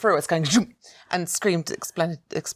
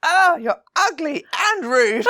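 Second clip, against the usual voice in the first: slow, giggling mock put-down; laughing insult; 12 semitones higher, 6 dB louder, slower than usual. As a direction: hold back a laugh while insulting him. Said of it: "I went".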